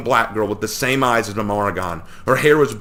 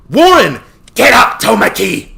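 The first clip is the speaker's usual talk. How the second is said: much higher and louder roar; 12 semitones higher, 11 dB louder, slower than usual. A narrator's reading. Warren roars at Tomoki to get up, loud roar. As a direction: bellow it across the field. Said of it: "Warren roared".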